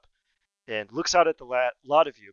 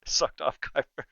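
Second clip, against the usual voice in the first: ordinary talk, nothing marked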